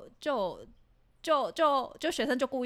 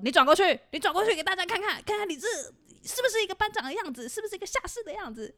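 harsh command; 10 semitones higher, 5 dB louder, faster than usual. Retold or imitated. Senior humiliating her before troops.

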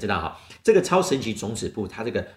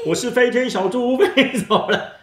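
high, loud gleeful piglet; flying piglet; 13 semitones higher, 5 dB louder, slower than usual. Pretend.

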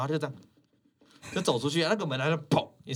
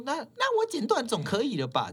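mock fierce customer, much higher; fierce snap again; 12 semitones higher than usual. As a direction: retell the rude comeback, higher. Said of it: "就这么凶欸". (模仿凶客人，音调拉高)

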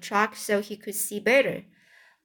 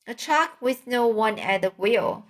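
usual speaking voice

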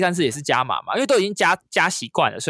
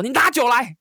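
high shout for wine; host shouting to colleagues to bring wine; 10 semitones higher than usual. Retold or imitated. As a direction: call out to the colleagues, pitched up. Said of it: "大声的跟他们喊说".

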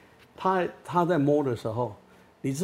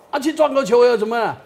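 put-on mouthy teen retort; 8 semitones higher, 7 dB louder than usual. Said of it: "他好像说".

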